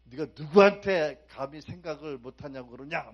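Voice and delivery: loud, thundering mock rebuke; pretend rebuke. Preacher imagining God scolding Martha, loud pretend rebuke. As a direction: bellow it like an offended master. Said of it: "야단치시나요?".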